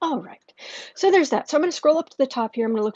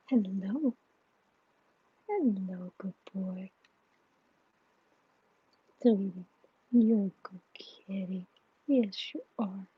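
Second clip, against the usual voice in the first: low, hushed murmur to a cat; soft half-whispered sweet talk for the kitty; cooing to pet; 3 semitones lower, 7 dB quieter than usual. Real, not acted.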